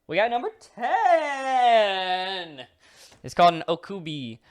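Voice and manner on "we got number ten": high, loud, slow announcer call. Acted. Host presenting the next monster to viewers, slow high announcer voice. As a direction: boom it like a show host.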